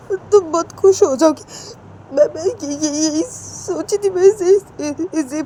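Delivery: high tearful sobbing plea; weeping, voice cracking; crying. Acted. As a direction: explain through breaking sobs. Ritu keeps crying throughout.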